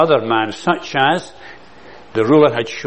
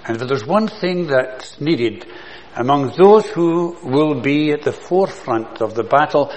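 ordinary talk, nothing marked

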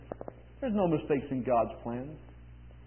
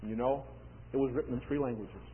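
ordinary talk

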